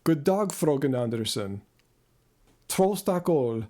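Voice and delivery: careful stab at speaking Swedish; attempted Swedish. Shown as a demonstration. Showing viewers his Swedish after a trip there, Swedish accent. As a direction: try out Swedish phrases with a Swedish accent. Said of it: "let me try Swedish".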